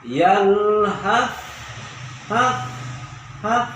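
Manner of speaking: yalhaf with the f breathed out, slow; breathy final f. Shown as a demonstration. Teacher showing the airy letter in yalhaf. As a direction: let the haf end in a stream of air. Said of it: "Jadi anginnya keluar".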